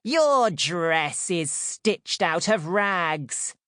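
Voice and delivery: slow, high sneering sister; mock her rags; mocking stepsister. A narrator's reading. Stepsister sneers at Cinderella's ragged dress.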